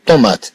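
طومات, the middle consonant a true m, not n; m pronounced as m. Shown as a demonstration. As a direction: say طومات with a clearly sounded m. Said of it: "هنا نقرأ الميم ميمًا". (نطق الميم ميمًا في طومات)

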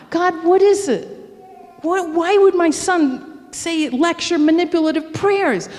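high, pained asking; bewildered plea. Retold or imitated. Mother asks God why her son hurt her, pained. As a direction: plead for understanding.

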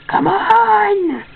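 frustrated high-pitched plea; exasperated high plea. Real, not acted. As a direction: beg the computer, pitch up high. Computer lagging, pleading louder.

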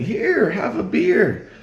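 high, slow put-on offer; offering a beer. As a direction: hold out a beer in a squeaky voice. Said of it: "They're like".

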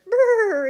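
loud, high acted shiver; shivering "brr". A narrator's reading. Snowman cold out in the wind, shivering. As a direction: trill a big cold "brr". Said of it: "But Sneezy the snowman shivered".

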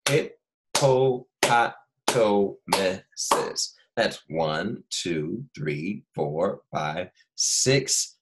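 Hippopotamuses pulled apart into separate syllables, very slowly; counting syllables. Shown as a demonstration. Teacher counting syllables with class, very slow. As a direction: beat out each syllable of Hippopotamuses. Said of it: "How many syllables does it have?".

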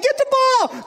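high, urgent yell; yelling from sideline. Retold or imitated. Parents yelling to dancing Baylor, high urgent call. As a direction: call out to the boy to get the ball. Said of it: "we're all like".

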